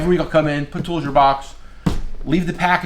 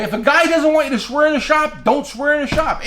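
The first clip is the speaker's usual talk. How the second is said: emphatic advice; 10 semitones higher than usual. Real, not acted.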